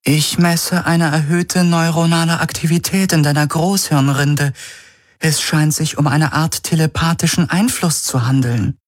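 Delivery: high-pitched, measured analytical voice; ship's AI Helix, concerned. A narrator's reading. The Helix reports brain readings to Garland, measured concern.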